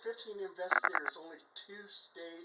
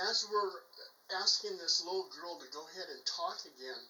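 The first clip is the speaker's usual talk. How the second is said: ordinary talk, nothing marked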